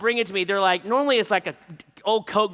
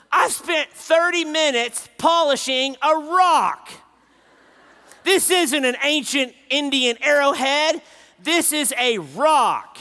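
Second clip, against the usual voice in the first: loud, high exasperated rant; teen daughter complaining she polished a rock; 6 semitones higher, 6 dB louder than usual. Retold or imitated. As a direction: vent the disappointment in mock outrage.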